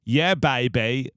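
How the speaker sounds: slow, drawn-out catchphrase impression; Austin Powers. Impersonator agreeing to come on the podcast, Austin Powers impression. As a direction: stretch it out like a swinging spy. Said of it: "And Austin's like".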